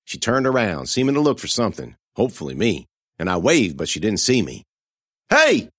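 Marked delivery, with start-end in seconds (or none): 5.3-5.7 high, loud call across the park. A narrator's reading. Girl calling to a friend across the park, a loud call. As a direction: call out to catch her attention. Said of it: "I cried".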